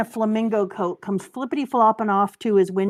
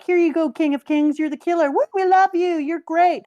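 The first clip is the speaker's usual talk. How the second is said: high, loud adoring cheer; mock crowd adoring their king; 6 semitones higher, 4 dB louder than usual. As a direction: whoop it up like a fan crowd.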